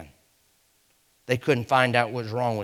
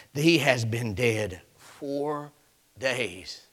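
ordinary talk, nothing marked

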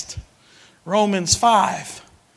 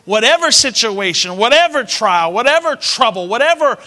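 louder, higher with each whatever; building shout; 5 semitones higher, 6 dB louder than usual. Acted. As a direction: preach it up, climbing. Building preacher repetition.

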